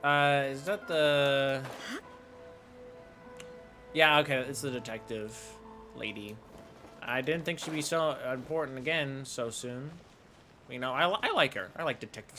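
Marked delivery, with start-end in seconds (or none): none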